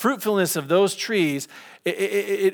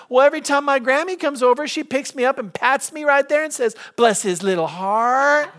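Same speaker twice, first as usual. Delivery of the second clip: put-on high, excited child voice, speeding up; a kid proudly explaining his Grammy; little boy voice; 10 semitones higher, 4 dB louder than usual. Brady tells the teacher about Grammy, high and eager.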